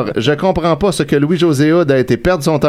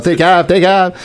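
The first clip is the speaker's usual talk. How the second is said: mock insult; 4 semitones higher than usual. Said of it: "il l'insulte".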